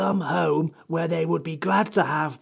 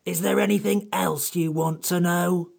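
high, tetchy creature voice; cross; 13 semitones higher than usual. Acted. Samiad fed up with questions, crossly. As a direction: put the question irritably, pitched high. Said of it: "said the Samiad crossly".

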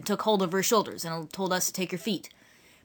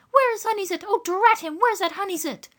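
high, fretful rustic woman; flustered country woman; 12 semitones higher than usual. A narrator's reading. Woman at a loss what to do, high flustered voice. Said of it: "She kept on saying".

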